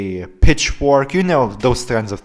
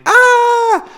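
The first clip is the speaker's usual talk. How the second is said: very high, loud "ah" shriek; girlish scream; 6 dB louder than usual. Retold or imitated. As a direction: imitate your own startled scream, high-pitched. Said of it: "when I was screaming like".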